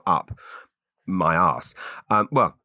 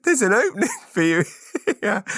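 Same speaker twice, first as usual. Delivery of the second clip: laughing; 11 semitones higher than usual. Real, not acted. Host laughing at his own sex-shop pun. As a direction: chuckle through the pun.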